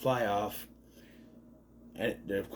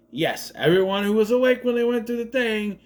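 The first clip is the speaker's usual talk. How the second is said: ordinary talk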